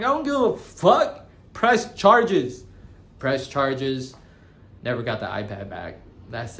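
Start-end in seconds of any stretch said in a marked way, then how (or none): 0.0-1.3 much higher and louder, mock-furious; defiant outburst. Retold reply to the detective. 1.5-2.6 high, loud, insistent mock yell; angry demand. Retold reply to the detective.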